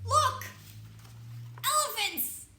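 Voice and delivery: Look! Elephants! bursting high and loud; excited. Acted. Reader to children, excitedly spotting the elephants. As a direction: cry out in delight at the elephants.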